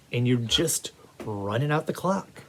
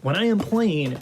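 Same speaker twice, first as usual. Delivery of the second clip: usual speaking voice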